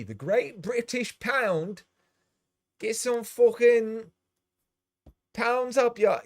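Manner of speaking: high, slow, loud hammed-up British accent; over-the-top British accent, loud and drawn out; mock British accent. Mocking British pounds payout.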